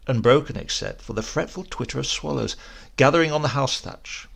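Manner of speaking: ordinary delivery, nothing marked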